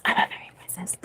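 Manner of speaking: whispered aside. Real, not acted.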